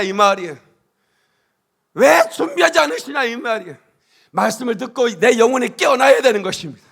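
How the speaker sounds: loud, high fervent preaching, rising to shouts; booming revival sermon delivery; full-force preaching. Acted. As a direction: preach it out to the whole congregation.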